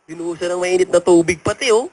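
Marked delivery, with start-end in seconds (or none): none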